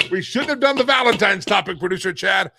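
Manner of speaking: loud, high mock complaint; exasperated shout. Real, not acted. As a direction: yell at the producer in mock despair. Exasperated at dirty comments.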